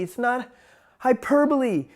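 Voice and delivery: ordinary talk, nothing marked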